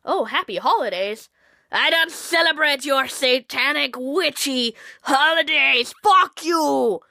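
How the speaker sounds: slow, loud, sneering rant building to a shout; hammed-up bigot snapping at a greeting; mock hostile greeter. Mocking someone furious at a friendly greeting, loud sneer.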